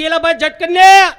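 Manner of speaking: loud, urgent yell to hurry; shouted plea. Retold or imitated. Man outside yells loudly to the one inside. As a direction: holler at him to hurry up. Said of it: "રાડ નાખી".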